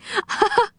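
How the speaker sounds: high-pitched giggle; short high burst of helpless laughter; laughing. A narrator's reading. Children laugh at poor drenched Rick, high giggle. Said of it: "couldn't help laughing".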